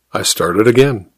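plain reading voice

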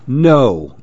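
plain reading voice

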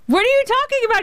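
high-pitched incredulous cry; stunned disbelief. Retold or imitated. Director to agents on surprise news, stunned disbelief.

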